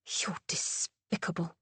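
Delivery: cold whispered contempt; icy whisper to Mister Thane. A narrator's reading. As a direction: whisper it like ice. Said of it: "she said icily".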